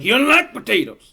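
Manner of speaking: rustic heckler. Acted. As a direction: butt in with mock indignation. Repeats the rustic interjection.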